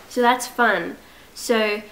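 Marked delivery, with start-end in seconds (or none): 1.3-1.8 put-on whispered British accent, slow; hushed put-on British accent. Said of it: "why am I doing a British accent?".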